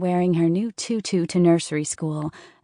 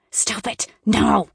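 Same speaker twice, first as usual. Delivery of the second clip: angry hiss; 4 semitones higher, 6 dB louder, slower than usual. A narrator's reading. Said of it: "Amy hissed".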